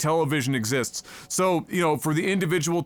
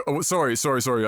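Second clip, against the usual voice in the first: ordinary delivery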